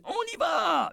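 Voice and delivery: huge, high battle shout. A narrator's reading. Eddie yells the signal to attack the guards. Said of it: "hurla Eddie".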